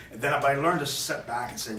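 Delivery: ordinary talk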